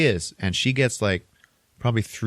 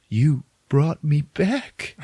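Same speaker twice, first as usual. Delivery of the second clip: slow, higher-pitched hammed-up wonder; mock revived patient; 7 semitones higher, much slower than usual. Revived man looks into her eyes, mock wonderment. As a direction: draw it out high, imitating someone just revived.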